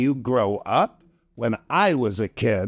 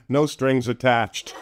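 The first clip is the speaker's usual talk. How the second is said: ordinary delivery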